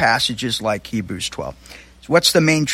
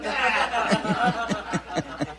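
laughing; 7 semitones higher, 8 dB quieter than usual. Real, not acted. Laughs at own joke.

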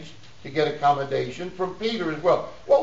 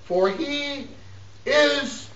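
high, suspended phrases with long pauses; emphatic scripture reading; 10 semitones higher, slower than usual. Acted. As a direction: hold the congregation on each word. Declaims scripture to congregation.

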